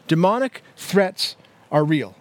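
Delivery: slow, deliberate restatement with spaced words; slow repeat. Real, not acted. Preacher repeating his first teaching point to the congregation, slowly.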